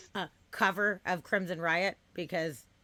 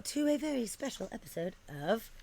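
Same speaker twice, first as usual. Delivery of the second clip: announcer voice; 5 semitones higher, 5 dB louder than usual. Acted. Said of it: "podcast host opening the show".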